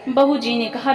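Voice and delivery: ordinary delivery, nothing marked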